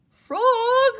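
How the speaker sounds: very high, loud call. Acted. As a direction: call Frog out proudly. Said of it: "cried Toad".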